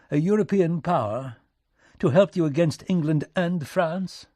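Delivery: astonished British diplomat; incredulous. Acted. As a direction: scoff at the very idea. Minister incredulous at Lincoln's threat.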